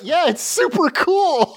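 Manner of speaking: high-pitched, gleefully proud retort; imitated proud boast. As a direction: play him hugely pleased with himself. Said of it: "He's so proud".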